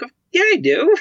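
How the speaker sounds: high-pitched, loud comeback; mock-indignant squeal back at a scolder; defiant retort. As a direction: jump up high and insist.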